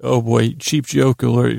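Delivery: ordinary delivery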